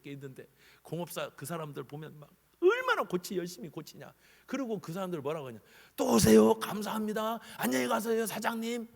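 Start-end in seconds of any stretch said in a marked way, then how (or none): 5.9-8.9 higher, loud eager farewell; car mechanic thanking his boss-customer; cheerful mechanic. Retold or imitated. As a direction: send the customer off brightly. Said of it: "막 그래".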